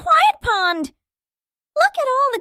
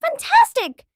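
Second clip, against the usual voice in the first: excited praise; 7 semitones higher, 4 dB louder than usual. Acted. Praising child.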